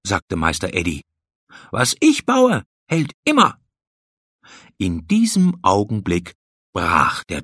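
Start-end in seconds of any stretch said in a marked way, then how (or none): 1.7-3.6 high-pitched, cocksure boast; boastful confidence. A narrator's reading. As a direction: brag like a master builder. Builder boasts about his dam.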